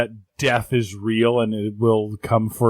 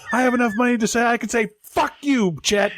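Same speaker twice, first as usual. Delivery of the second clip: ranting anchor; 9 semitones higher than usual. Retold or imitated.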